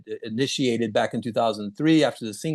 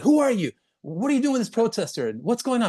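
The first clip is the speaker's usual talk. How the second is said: reporters shouting questions; 11 semitones higher, faster than usual. Retold or imitated.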